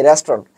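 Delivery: "রেস্টুরেন্ট" low and compact as res-tron; "রেস্টুরেন্ট" with the middle vowel dropped; correct pronunciation. Shown as a demonstration. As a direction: say "রেস্টুরেন্ট" in two syllables, "restron". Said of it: "pronunciation কি?".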